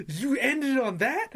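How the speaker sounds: high, loud, drawn-out incredulous question; mock-outraged, slow questioning yelp; heated disbelief. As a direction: replay the outrage, pitch rising at the end. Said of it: "I remember being heated".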